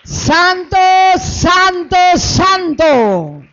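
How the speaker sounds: loud, high, ringing acclamation; shouted praise. Real, not acted. Pastor praising God amid applause, exultant shout. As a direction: cry out each word to the whole crowd.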